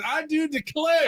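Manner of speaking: high, drawn-out Southern belle; put-on genteel Southern exclamation; Southern drawl. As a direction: stretch it out in a hammy Southern accent. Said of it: "thing he didn't say is".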